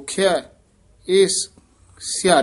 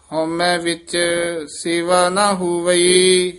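measured, chanted scripture reading; scripture recitation with long pauses; slow Gurbani recitation; 3 semitones higher, 6 dB louder, slower than usual. Shown as a demonstration. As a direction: recite each phrase slowly and devoutly. Teacher chants Gurbani path.

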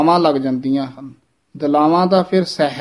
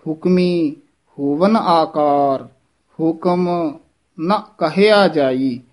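breaks after Hukmi and ਹੁਕਮ; ਹੁਕਮ, pause, measured phrase; pause after word; slower than usual. Shown as a demonstration. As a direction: set Hukmi apart, then recite slowly. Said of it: "ਹੁਕਮੀ ਪਦ ਤੇ ਯਮਕ ਲਾ ਕੇ".

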